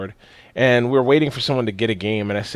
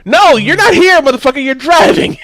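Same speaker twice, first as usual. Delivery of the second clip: high, loud yelling rant; shouted rant; 12 semitones higher, 8 dB louder than usual. Retold or imitated. Mocking friend's logic, high loud yelling rant. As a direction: yell it at him like he's hopeless.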